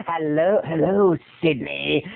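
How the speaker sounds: low, creepy caller voice; imitating a sinister deep-voiced caller; menacing phone terrorizer. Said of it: "the guy who was terrorizing her on the phone".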